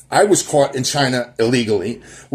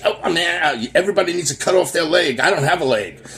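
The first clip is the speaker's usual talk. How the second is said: higher, mocking self-pity; mock whiner; 5 semitones higher than usual. Mocking people who feel owed, hammed-up whining. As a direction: play a complaining victim, a bit higher. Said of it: "Or I, I say".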